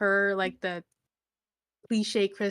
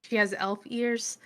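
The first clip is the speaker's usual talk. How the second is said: ordinary delivery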